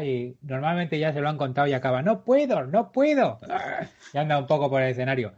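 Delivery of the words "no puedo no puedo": mock-dignified foreigner, high swooping pitch; high-pitched hammed-up stage line; American guest's plea. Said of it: "viene alguien ahí con toda, un americano, con toda su dignidad".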